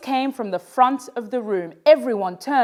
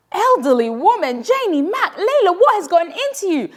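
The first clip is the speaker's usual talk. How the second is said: offended teacher; 9 semitones higher than usual. A narrator's reading.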